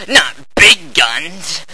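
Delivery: normal talking voice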